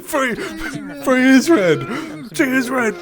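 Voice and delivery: high, loud insisting; loud insistence. Real, not acted. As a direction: shout it through laughter. Insisting loudly.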